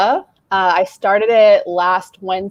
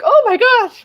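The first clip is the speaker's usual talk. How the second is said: shocked gasp; 5 dB louder than usual. Retold or imitated. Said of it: "I was like".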